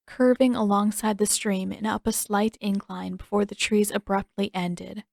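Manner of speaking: ordinary delivery, nothing marked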